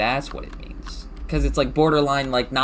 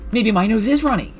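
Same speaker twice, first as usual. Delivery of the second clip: higher, louder mock realization; mock alarm; 10 semitones higher, 6 dB louder than usual. Player imitates a worried symptom-searcher, mock alarm. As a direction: pitch it up in pretend alarm. Acting someone imagining symptoms.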